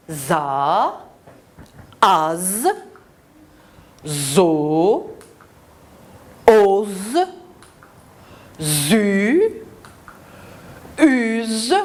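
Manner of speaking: ZA, AZ, ZO drawn out one by one; long vowels and buzzing z, a pause after each; teacher modelling isolated Z syllables; syllable drill. Shown as a demonstration. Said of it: "des syllabes avec la consonne Z".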